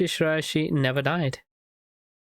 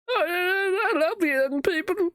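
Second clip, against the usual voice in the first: put-on much higher old-lady voice; sweet old granny; much slower than usual. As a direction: coo at the agents. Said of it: "this is some old granny".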